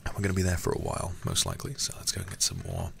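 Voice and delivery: usual speaking voice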